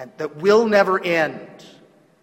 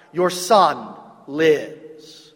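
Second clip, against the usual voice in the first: slow proclamation; 4 semitones lower, much slower than usual. Acted. Preacher savours the fulfilled promise.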